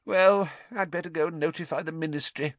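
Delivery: high-pitched, flustered complaint; Fudge puffing. A narrator's reading. Minister dreading becoming a laughing stock, flustered. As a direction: fret aloud about the coming embarrassment. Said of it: "puffed Fudge".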